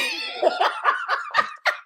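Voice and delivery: big high shrieking laugh. Real, not acted. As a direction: burst out laughing. Laughing after the story.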